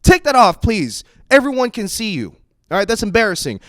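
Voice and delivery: high, loud, frantic pleading; loud embarrassed yelling at mom; panicked yell. Retold or imitated. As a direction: shout at her to take it off. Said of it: "And I was like".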